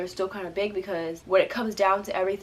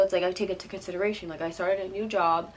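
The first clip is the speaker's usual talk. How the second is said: usual speaking voice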